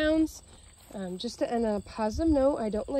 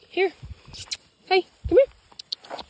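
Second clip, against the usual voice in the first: much higher, very slow coaxing call; 9 semitones higher, 3 dB louder, slower than usual. Real, not acted. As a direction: sing him back to you. Calling the dog back.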